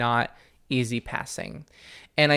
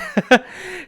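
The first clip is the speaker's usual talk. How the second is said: loud high real laughter; 6 dB louder than usual. Real, not acted. Showing listeners the teeth trick, real laugh. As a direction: laugh out at yourself.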